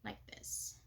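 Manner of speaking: normal talking voice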